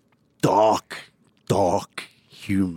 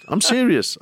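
emotional; 12 semitones higher, 3 dB louder than usual. Real, not acted. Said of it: "I'm emotional".

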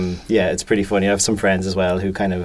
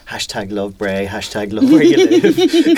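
imitating wife; 7 dB louder, slower than usual. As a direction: sing it out like his wife. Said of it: "she always says".

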